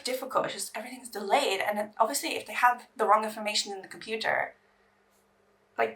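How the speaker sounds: ordinary talk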